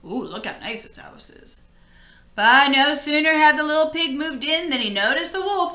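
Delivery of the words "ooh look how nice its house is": soft aside. Real, not acted. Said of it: "aside to listeners".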